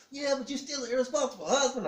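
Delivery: high, loud mock wifely retort; scolding wife. Wife answering husband's told-you-so, scolding. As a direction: imitate a wife's piercing complaint.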